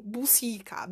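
bucica breathed in a whisper. Shown as a demonstration. Whispered word to the mic.

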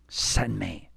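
quiet offer. Acted. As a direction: offer yourself softly. Isaiah answers God, first take.

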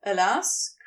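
ordinary delivery, nothing marked

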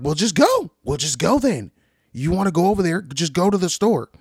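loud, high-pitched fed-up retort; mock exasperated arguing. Traveler telling companion to just go, loud and exasperated. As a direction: snap it back like a bickering traveler. Voices people arguing on a trip.

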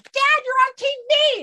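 put-on very high, loud, drawn-out kid's shout; little kid. Kid calls dad to the TV, excited child voice. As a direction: imitate an excited child calling a parent. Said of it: "when I was a little kid".

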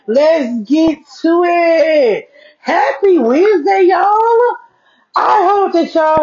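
high, bursting with excitement; excited greeting. Real, not acted. Podcast host greeting her listeners, high and excited. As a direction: greet the listeners like it's a party.

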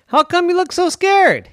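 squeaky cheerful creature; high friendly walrus voice. Acted. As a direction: chirp it brightly. Walrus asks girl.

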